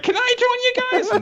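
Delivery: very high cartoon shark asking; high goofy creature voice; silly high shark voice. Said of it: "Jabberjaw's like".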